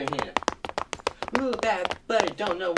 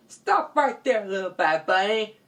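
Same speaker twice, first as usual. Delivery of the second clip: high, loud hero's order; commanding challenge; 5 semitones higher, 6 dB louder than usual. Acted. Hero pops out to confront thief, loud command.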